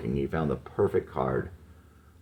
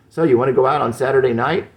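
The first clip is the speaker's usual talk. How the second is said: loud, fast and biting; unpleasant tone; 9 semitones higher, 8 dB louder, faster than usual. Acted. Jesse to Mandy on the stairs, sharp and loud. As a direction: snap the question at her, fed up. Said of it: "This was not Jesse's most pleasant tone of voice".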